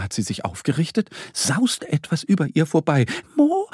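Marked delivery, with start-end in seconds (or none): 3.4-3.7 high, squeaky little duck seeking help; mock whisper. A narrator's reading. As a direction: call your friend in a tiny high voice. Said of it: "flüstert Annie". (hohes, ängstliches Entenstimmchen)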